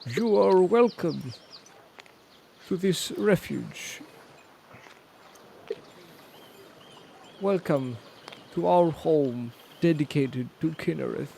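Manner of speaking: a hint of a formal priest; solemn priest. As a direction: greet the travellers like a temple priest, formally. GM voices the priest.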